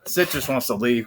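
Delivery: usual speaking voice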